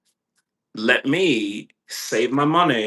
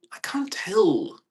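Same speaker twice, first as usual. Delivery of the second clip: whisper to dog; 8 semitones higher, slower than usual. Real, not acted. Talking to his dog.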